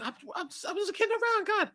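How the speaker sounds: high, fast whiny bitchy voice. Retold or imitated. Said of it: "I get what they call a bitch".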